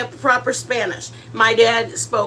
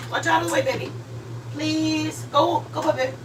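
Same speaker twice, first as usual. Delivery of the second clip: coaxing the dog; 4 semitones higher, 6 dB quieter than usual. Real, not acted. Shooing the dog away.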